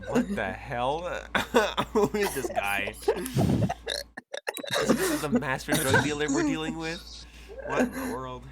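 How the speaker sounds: baffled goons. Retold or imitated. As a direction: gasp at it like stunned henchmen. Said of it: "being like".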